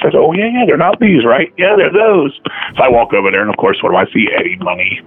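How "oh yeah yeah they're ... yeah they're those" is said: mock chirpy exchange. Dialogue with lady, voices raised.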